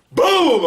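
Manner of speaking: sudden loud boom, high; imitated boom. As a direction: shout the explosion sound. Cigar explodes, imitated blast.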